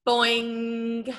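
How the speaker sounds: long, ringing boing; sustained, level boing; bouncing ball sound. Shown as a demonstration. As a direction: draw it out like a springy ball. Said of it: "Can you copy me?".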